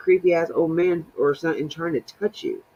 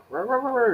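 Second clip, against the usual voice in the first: imitated dog barking; 9 semitones higher, faster than usual. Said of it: "the dog was, like, standing up".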